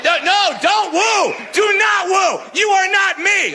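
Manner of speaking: high, insistent telling-off; scolding the crowd. Real, not acted. Comedian telling the audience not to woo, high-pitched scolding. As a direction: shut the cheering down firmly.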